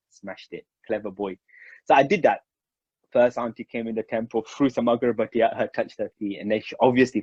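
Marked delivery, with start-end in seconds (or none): 0.1-1.4 soft, pleased inner voice; self-congratulating thought. Retold or imitated. Boy praising himself for the cunning plan, softly. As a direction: murmur it to yourself, smug. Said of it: "And I thought".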